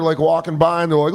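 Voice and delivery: ordinary talk, nothing marked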